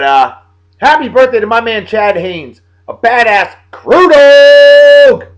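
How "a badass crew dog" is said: drawn-out hype. Acted. Hyping friend in shout-out.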